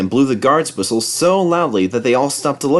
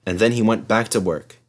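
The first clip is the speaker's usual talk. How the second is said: plain reading voice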